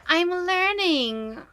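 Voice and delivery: slow playful brag; drawn-out proud. Pretend. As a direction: stretch it out showing off.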